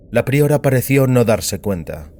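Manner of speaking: ordinary delivery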